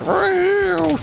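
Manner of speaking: high mock cat meow; imitated meow. Gamer imitating the wrestler fighter's meow, high and silly. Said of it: "He's like".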